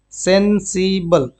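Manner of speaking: teacher slowly modelling sensible; slow pronunciation demo. Shown as a demonstration. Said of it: "pronunciation इसका देखेंगे क्या?".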